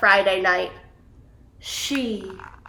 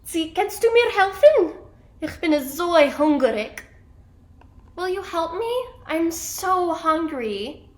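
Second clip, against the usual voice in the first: high-pitched plea for help; hungry character begging; pleading question; 6 semitones higher than usual. A narrator's reading. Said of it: "She asks some pigeons".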